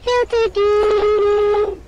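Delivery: very loud, slow drawn-out bellow; megaphone shout. Acted. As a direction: blast it like through a megaphone. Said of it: "Did she just get a megaphone?".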